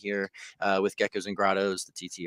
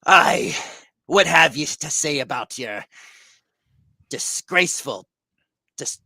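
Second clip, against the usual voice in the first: raised, accusing captain voice; captain's accusation; 6 semitones higher, 5 dB louder than usual. Acted. Captain accusing the prisoner of betrayal, raised voice. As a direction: confront the traitor as the pirate captain. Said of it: "and he says".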